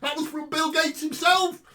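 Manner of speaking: high, loud, fiery preaching; preaching at full force. Acted. Preacher urging passersby to read the Bible, fiery and loud. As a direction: urge them on at the top of your voice.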